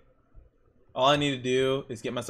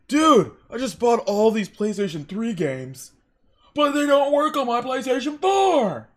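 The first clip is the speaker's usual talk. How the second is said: high, loud dopey customer; 10 semitones higher, 7 dB louder than usual. Retold or imitated. Clerk mocks a confused PlayStation customer, high and loud. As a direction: complain like a clueless gamer.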